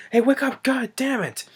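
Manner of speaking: high-pitched, held-back retold shout; mock yell. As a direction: imitate yelling someone awake without real volume. Retold shouting to wake a driver.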